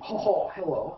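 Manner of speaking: a bad, put-on Russian impersonation; Russian accent. Shown as a demonstration. Host shows off a Russian impersonation to coworkers, joke Russian accent. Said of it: "Russian accent? ... Or my Russian impersonation?".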